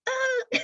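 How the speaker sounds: short high yelp, retold; a sudden high yelp as he lands on the roof; mock scream. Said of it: "and he goes".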